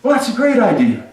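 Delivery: high, loud and laughing; gleeful king. Acted. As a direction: relish the twist, laughing through it. King springs trap on Haman.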